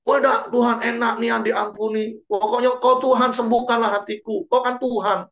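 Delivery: high, petulant protest; mock a believer bossing God around; hammed-up petulant complaint; indignant whiny refusal. Believer refusing God's command.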